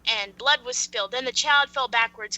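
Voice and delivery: normal talking voice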